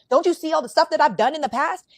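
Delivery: loud, high exasperated outburst; angry man. Retold or imitated. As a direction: shout it out, fed up. Voicing upset man.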